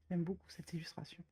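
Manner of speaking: admiring whisper to herself. Real, not acted. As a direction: breathe it out, fond and quiet. Admiring the drawing softly.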